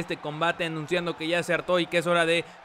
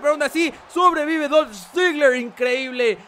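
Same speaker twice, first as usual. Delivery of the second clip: wrestling commentator; 7 semitones higher, 5 dB louder than usual. Acted. Hyped wrestling play-by-play.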